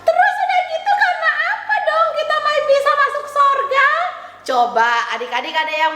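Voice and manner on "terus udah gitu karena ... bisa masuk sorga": high, cheery puppet voice; chirpy child puppet saying hello; puppet girl Tiur. Acted. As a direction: greet the kids as the little puppet.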